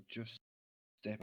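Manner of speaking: barely voiced warning; whisper. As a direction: whisper to the deer. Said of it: "I will just whisper".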